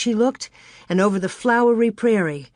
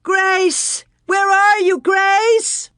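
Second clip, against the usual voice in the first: high, loud distant shouts; shouting; 12 semitones higher, 5 dB louder, much slower than usual. A narrator's reading. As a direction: shout into the tall grass. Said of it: "Pa's shouts and Ma's".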